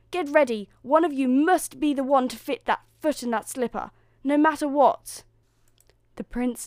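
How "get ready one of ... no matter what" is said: loud, high, urgent orders; yelling. A narrator's reading. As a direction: drive the daughters on as stepmother. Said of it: "yelled the stepmother".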